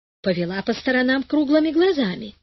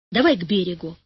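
angry; 5 semitones lower, faster than usual. A narrator's reading. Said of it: "рассердился Карик".

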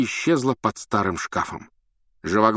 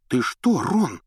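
taken aback; 5 semitones higher, slower than usual. A narrator's reading. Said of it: "опешила Гермиона".

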